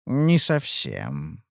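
low, slow smirking voice; smirking dealer. A narrator's reading. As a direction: drawl it with a smirk. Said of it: "ухмыльнулся делец".

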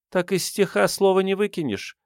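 high girlish voice, mock sorrow; woeful girl aristocrat. A narrator's reading. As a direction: lament lightly in a high pitch. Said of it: "горестно подпёрла щёку аристократка".

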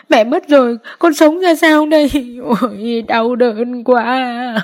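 high keening lament, slowing at the end; wailing. A narrator's reading. Daughter-in-law calling to her dead mother, high keening wail. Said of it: "gào lên".

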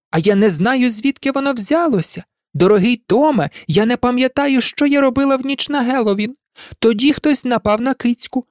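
put-on high-pitched parody of a distressed girl; mocking mimicry. A narrator's reading. Riddle reading out Ginny's diary to taunt frightened Harry, mocking mimicry. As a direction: mimic her panicked diary in a high, plaintive voice. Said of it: "кривлявся Редл".

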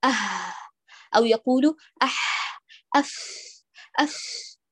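أح and أف as bare unvoiced breath; just push air through أه أح أف without any friction; wrong airy letters. Shown as a demonstration. Teacher imitating a reader's faulty breathy letters, whispered air only. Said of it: "فيقول هكذا".

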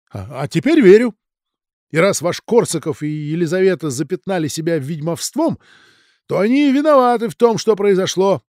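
angry outburst. Acted. Ранeвский accuses guests of witchcraft.

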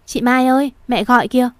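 calling out. Acted. Narrator calls Mai to come to mother, calling out. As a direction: call her in from outside. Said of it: "đưa giọng ra ngoài gọi chị Mai".

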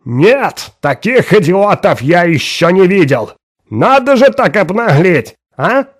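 high, loud, cracking shout; breaking voice shout. Acted. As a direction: yell till the voice breaks. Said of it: "срывающимся голосом закричал".

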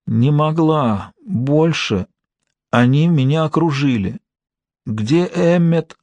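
slow, faint, strained words; weak dying voice. A narrator's reading. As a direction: barely get the words out. Dying woman speaking weakly.

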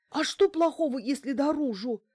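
high, loud anxious question; frightened whimpering. A narrator's reading. Bankina fretting about her front door, frightened whimper. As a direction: whine it high, close to panic. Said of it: "спросила оператор и заскулила".

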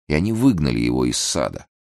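usual speaking voice

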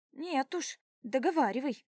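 insistent. A narrator's reading. She blocks him, insists.

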